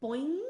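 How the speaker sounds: "Boing." gliding upwards like a spring; rising second tone. Shown as a demonstration. Teacher pictures a spring for children, "Boing." rising. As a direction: let "Boing." spring up in pitch. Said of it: "a spring going upwards".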